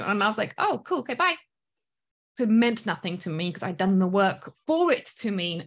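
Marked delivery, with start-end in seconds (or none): 0.6-1.4 high, chirpy retold dismissal; airy, couldn't-care-less reply; breezy brush-off. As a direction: brush them off cheerfully, voice pitched way up.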